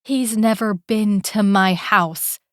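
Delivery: slow, every word of "He's never been to my house" hit separately; each word emphasized. Acted. Woman denying him to Calum, slow with every word stressed. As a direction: lay each word down deliberately. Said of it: "emphasizing each word".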